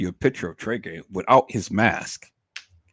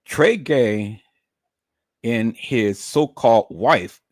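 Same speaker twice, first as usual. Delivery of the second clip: ordinary delivery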